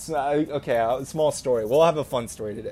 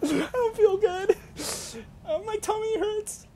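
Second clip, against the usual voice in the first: mock crying plea; 4 dB quieter than usual. Boy begging his mom, high mock-crying whine. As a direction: whimper like a kid about to cry. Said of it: "I'm like".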